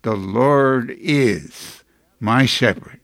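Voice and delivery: slow and sure, The Lord is my shepherd; slow, reverent, confident quote of David; confident reverence. Shown as a demonstration. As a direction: say it with calm, settled confidence. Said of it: "confidently say with the words of David".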